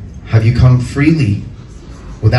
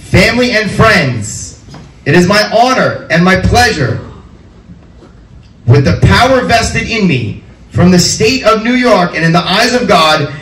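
higher and higher, building to a shouted announcement; grand announcer; 12 semitones higher than usual. Acted. Officiant pronouncing the couple husband and wife to the guests, building to an announcer's shout. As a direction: proclaim it like a ring announcer hyping the crowd.

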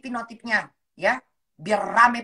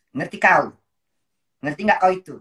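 "Ngerti kau?" repeated much louder and sharper; louder demand; 3 semitones lower, 5 dB louder than usual. Real, not acted. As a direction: hammer the question "Ngerti kau?" at the troll. ("Ngerti kau?" diulang lebih keras dan tegas)

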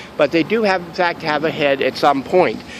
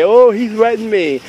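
mock complainer; 6 semitones higher, 3 dB louder than usual. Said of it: "So if they say".

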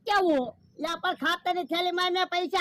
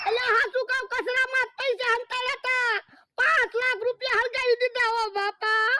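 high wailing lament over lost money; comic lament; 6 semitones higher than usual. Acted. Man mourning burned cash to friend, comic wailing lament. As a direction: wail it out in mock despair.

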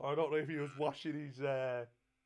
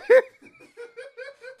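high wailing laugh; 16 dB louder than usual. Pretend. High wailing laugh mid-breakdown.

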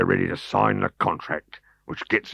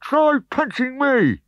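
taunting; 5 dB louder, slower than usual. Acted.

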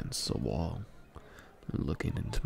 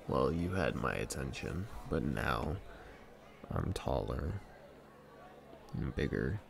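normal talking voice

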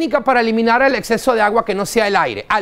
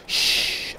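air syringe hiss; 8 dB quieter than usual. Shown as a demonstration. Imitates air.

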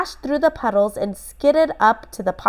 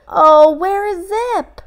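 put-on high, louder boy voice, worried; a boy near despair over his dog; worried boy Ben; 11 semitones higher, 5 dB louder than usual. A narrator's reading. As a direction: Ben calling anxiously for Zip. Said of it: "said Ben".

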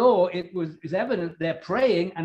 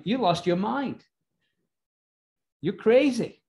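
higher-pitched, incredulous put-down; mock disbelief; 6 semitones higher than usual. Praying saints doubt the girl's news, acted disbelief. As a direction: dismiss her as out of her senses. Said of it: "and they say".

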